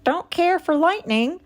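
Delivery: high goose voice; a fretful goose, pitched high; nervous goose. A narrator's reading. Goose tells the others about the storm, high and nervous. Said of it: "said Goose".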